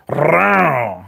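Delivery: rolled R falling away; a clumsy go at a trilled growl; attempted trilled growl. Shown as a demonstration. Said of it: "that sucked".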